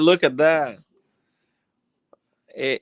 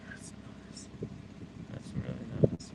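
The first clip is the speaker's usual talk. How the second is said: hushed, breathless whisper; 13 dB quieter than usual. Real, not acted. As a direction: whisper it in awe. Admiring the car interior up close.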